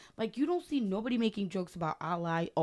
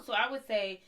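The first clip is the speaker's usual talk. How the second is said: ordinary talk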